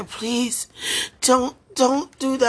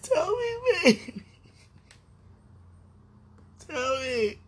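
crying; 4 semitones higher, 4 dB quieter than usual. Real, not acted. Sobbing plea for an answer.

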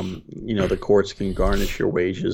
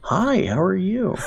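polite bot; 11 semitones higher, slower than usual. Retold or imitated.

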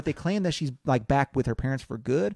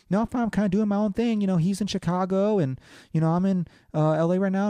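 higher-pitched mock girl voice; girl's casual reply; 7 semitones higher than usual. Host retells the girl brushing off questions, imitated in a higher voice. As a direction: lift the voice to imitate her.

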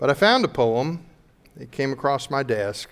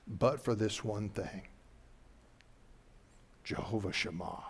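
soft, low, intimate preaching; hushed preaching; 3 semitones lower, 7 dB quieter than usual. Real, not acted. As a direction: draw them in, almost whispering. Quiet sermon close.

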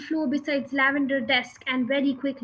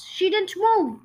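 high, loud, slow protest; the whole class calling out together; cried out; 6 semitones higher, 6 dB louder, slower than usual. A narrator's reading. Class crying out loud to defend Matilda. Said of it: "they cried out loud".

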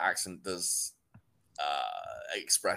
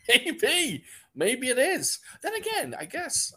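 amused; slower than usual. Real, not acted. Amused reaction to joke.

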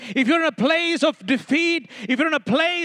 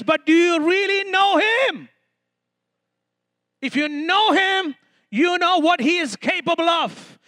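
high, insistent preaching; fervent exhortation; 4 semitones higher than usual. Real, not acted. Preacher telling the congregation what Jesus can do, fervent. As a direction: drive each repeated promise home, pitched high.

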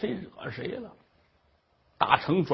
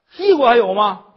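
loud, high nagging tirade; shrill scolding old mother; 5 semitones higher, 13 dB louder than usual. Acted. Mother-in-law scolds the crying daughter-in-law, loud and high.

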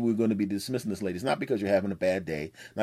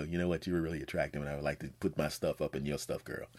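mock seduction; 6 semitones lower, 8 dB quieter than usual. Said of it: "they go".

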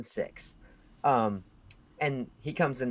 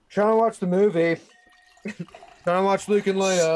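loud, high, whiny complaint; petty whine; 7 semitones higher, 8 dB louder than usual. Retold or imitated. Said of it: "like the pussy I am, I went".

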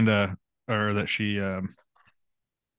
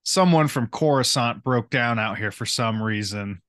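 ordinary delivery

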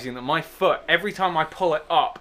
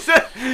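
real laugh; 8 semitones higher, 5 dB louder than usual. Real, not acted. Storyteller laughing at his own dumb plan, real laugh. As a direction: burst of high laughter.

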